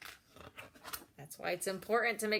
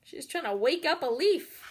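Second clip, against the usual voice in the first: ordinary delivery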